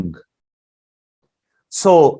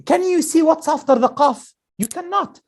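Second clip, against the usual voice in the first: usual speaking voice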